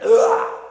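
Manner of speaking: loud, sudden roar; short full-volume bellow; boy's short roar. Acted. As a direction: let out a quick roar at a monster. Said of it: "Joey went".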